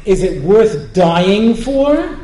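drawn out, slow and weighing each word; slow pointed question. Retold or imitated. Rabbi to host offering dessert, slow skeptical question. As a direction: stretch it slowly, testing the host. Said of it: "And I say".

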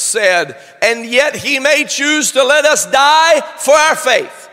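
loud, high, shouting sermon climax; fervent preacher shouting his trust; full-force preaching. Acted. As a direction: build the congregation up, crying out.

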